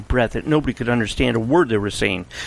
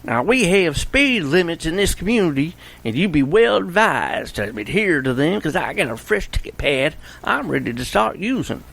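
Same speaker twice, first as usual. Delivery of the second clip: mock yell; 6 semitones higher than usual. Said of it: "followed them out the door and yelled".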